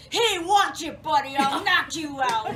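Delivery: loud, high, tough-guy threat; strong voice. Retold or imitated. Old dad threatens young doctor, loud and strong. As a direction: bark it like a feisty old man. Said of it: "he has this really strong voice".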